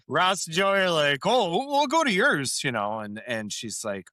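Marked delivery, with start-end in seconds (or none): 1.3-2.6 high, eager mock reply; eager quote. Ross and Joey jumping at the invite, eager. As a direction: jump at the offer, bright and keen.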